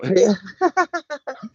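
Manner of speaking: high-pitched burst of laughter. Real, not acted. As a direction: crack up in a high laugh at your own story. Amused by his own story.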